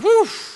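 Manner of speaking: sudden high whoosh, like a bursting pipe. Shown as a demonstration. Preacher showing pent-up feelings bursting out with a high whoosh. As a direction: shoot the sound up high and out.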